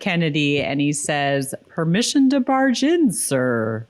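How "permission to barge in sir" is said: quoting a TV line, first part pitched up; Jack O'Neill line. Pretend. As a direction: recite the line like a fan. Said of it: "give us your best line reading".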